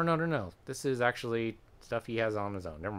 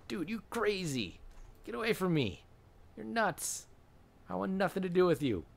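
mock-spooked and much higher pitched; alarmed fellow inmate; 9 semitones higher than usual. Fellow inmate recoiling from the bayonet guy, high mock alarm. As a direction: play a freaked-out inmate in a high comic voice.

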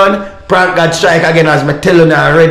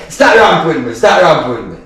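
ordinary talk

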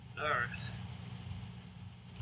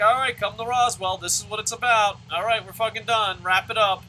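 mock announcement; 5 semitones higher, 9 dB louder than usual. Acts out an official's announcement.

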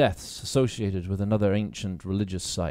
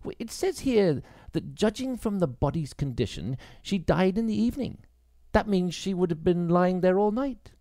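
higher, halting woman's voice; Grace, hesitant; 8 semitones higher than usual. Acted. Grace wondering at the temple, higher and hesitant. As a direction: stumble over the words, surprised.

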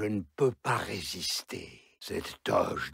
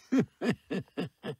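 high wicked cackle; gloating villain cackle; evil cackle; 8 semitones higher than usual. Acted. Villain laughs between lines.

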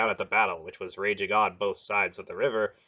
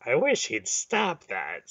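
irritably; 4 semitones higher, slower than usual. A narrator's reading. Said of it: "Silk said irritably".

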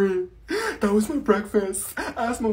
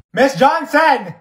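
drawn-out yell, higher and louder; slow yell; 5 semitones higher, 9 dB louder, much slower than usual. Acted. Student yelling for the ignoring teacher, slow loud yell. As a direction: bellow her name across the room. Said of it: "I have to say your name three times".

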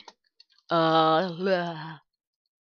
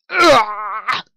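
frustrated groan; 3 semitones higher, 4 dB louder than usual. Real, not acted.

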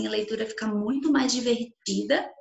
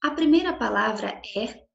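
ordinary talk

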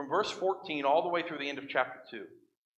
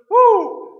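sudden high loud whoop; excited whoop; 13 dB louder than usual. Real, not acted. Preacher whooping at the crowd, high and loud. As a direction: let out a big mock-impressed woo. Exclaims at violent nation list.

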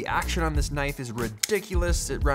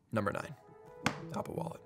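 quiet; 4 semitones lower, 13 dB quieter, faster than usual. Acted. Quiet recap of entry.

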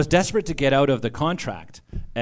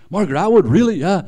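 higher and louder, an excited gasp of a name; imitating a thrilled publisher hearing a big name; excited disbelief; 7 semitones higher, 11 dB louder than usual. Publisher perking up on the phone at Atwood's name, excited.

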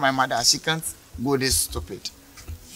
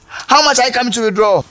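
much higher, louder repeated demand; angry shout; 9 semitones higher, 6 dB louder than usual. Acted. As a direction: bark the question at her. Officer demanding the amount, louder.

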